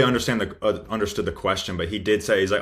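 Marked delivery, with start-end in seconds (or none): none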